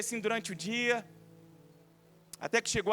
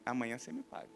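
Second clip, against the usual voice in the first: much lower, much softer menacing threat; 9 semitones lower, 9 dB quieter, faster than usual. Acted. Sister quietly threatens her brother, low and menacing. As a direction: growl it under your breath at him.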